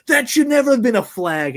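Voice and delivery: high, loud yell at a bad call. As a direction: shout it at the referee on screen. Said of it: "he yells out".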